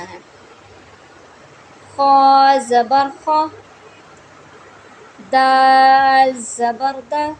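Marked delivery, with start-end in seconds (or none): 1.9-7.2 slow, loud syllable spelling; spelling drill. Shown as a demonstration. Teacher spelling out Qaida syllables for students, slow and loud. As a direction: spell each syllable out deliberately. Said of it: "ये हमने अभी हिज्जे किए हैं".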